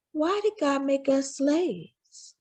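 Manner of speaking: put-on a small boy asking something heavy, slowly; troubled child. A narrator's reading. Frederick asking his mama about God, soft slow child voice.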